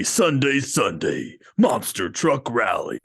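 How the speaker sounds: booming monster truck announcer. Pretend. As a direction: yell it like a rally promo. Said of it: "That was really impressive".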